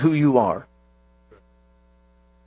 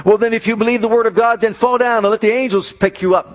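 higher, louder mocking tempter voice; taunting devil; 6 semitones higher, 3 dB louder than usual. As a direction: lure him in like a sly devil.

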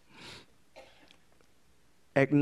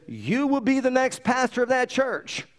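much higher, slow, booming proclamation; voice of God; 10 semitones higher, 3 dB louder, slower than usual. Retold or imitated. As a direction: announce it slowly from on high like a divine voice. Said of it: "louder than what I'm speaking to you".

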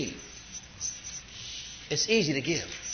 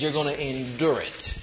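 normal talking voice

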